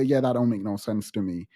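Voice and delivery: mock-blunt shrug of a line; blunt low aside. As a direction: toss it off flatly and fast. Her imagined blunt reply.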